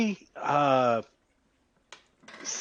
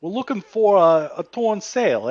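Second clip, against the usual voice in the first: put-on Brooklyn accent; 7 semitones higher, 3 dB louder than usual. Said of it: "Brooklyn accent tonight".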